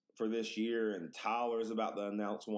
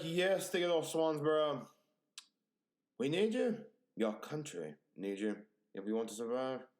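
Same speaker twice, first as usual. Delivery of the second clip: hammed-up plea, higher at first; mock military officer; 5 semitones higher than usual. Officer says the country needs him, mock dramatic. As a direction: plead like a commander in a war film. Imagined officer pleads for his service.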